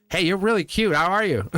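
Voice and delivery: high, forward pickup greeting; way out there. Retold or imitated. Demonstrating an out-there approach to a stranger, forward and high. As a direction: open boldly, high-pitched and eager. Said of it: "I call it way out there".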